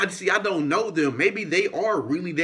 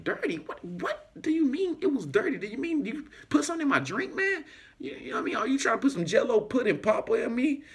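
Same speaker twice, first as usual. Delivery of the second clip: incredulous high squeak; 8 semitones higher, 4 dB quieter than usual. Retold or imitated. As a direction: pitch jumps way up, half-whispered disbelief. Retold confused reply to bartender.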